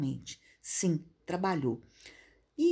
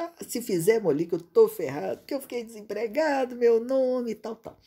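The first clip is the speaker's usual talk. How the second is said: mock panic; 5 semitones higher, 5 dB louder than usual. Student voiced panicking.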